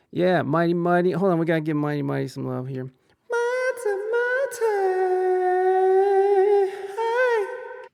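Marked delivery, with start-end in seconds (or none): 2.8-7.9 squeaky high-pitched shout-out; silly high greeting. Pretend. Giving a chatter named Mighty Mighty love in a squeaky voice. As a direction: call the name in a tiny cartoon voice.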